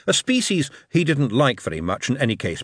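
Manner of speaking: usual speaking voice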